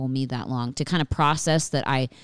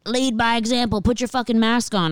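higher-pitched mock telling-off; mock scolding; 7 semitones higher than usual. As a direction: imitate preachy commenters, pitched up. Mimics scolding online commenters.

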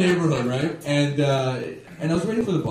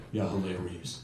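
low, quiet sarcastic aside; unamused; 12 semitones lower, 13 dB quieter than usual. Retold or imitated. Robbery victim humouring his laughing would-be robber, unamused. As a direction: drop it low and soft, not sharing the joke. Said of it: "He was doing more of the laughing".

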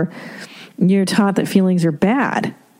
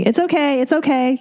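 soothing parent; 7 semitones higher than usual. Retold or imitated. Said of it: "when the kid's crying about something".

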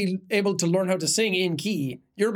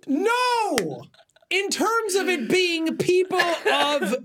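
indignant protest; 12 semitones higher, much slower than usual. Real, not acted. Protesting being misunderstood.

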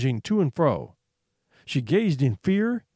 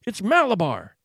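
restrained narrated scream, pitch raised; screamed, strange voice; 4 semitones higher than usual. A narrator's reading. Boy urging his rocking horse, mildly screamed. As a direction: cry it out in a powerful, strange voice. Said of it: "he screamed in a powerful, strange voice".

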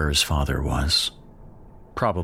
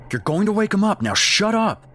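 sharp, raised, much higher voice telling her to shut up; angry hushing; 3 dB louder than usual. Acted. Dad snapping at the screaming mom to shut up, angry.

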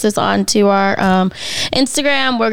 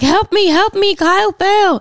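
mock frantic plea for help; imitating panicked mom; 8 semitones higher than usual. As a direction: call out like mom bursting in. Mother calling for help retold.

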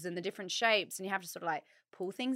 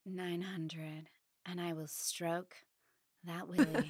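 soft, slow, low American seductress; sexy American hotline; 3 semitones lower, 7 dB quieter, slower than usual. Acted. Host playing a nine-hundred line lady, soft sultry American. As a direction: purr it slowly, mock-sultry American. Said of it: "lower and sexier".

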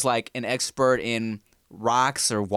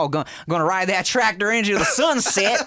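put-on Southern accent; 8 semitones higher than usual. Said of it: "I gotta do this Southern accent".

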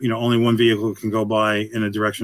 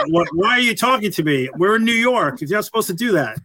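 higher, joking outrage at being greeted; mock indignant New Yorker; 8 semitones higher than usual. Joking to the host about New Yorkers, mock-offended and higher. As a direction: ham up the suspicious city dweller.

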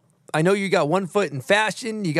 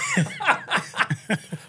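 high burst of laughter; 13 semitones higher, 4 dB quieter than usual. Real, not acted. Laughing at friend's reaction.

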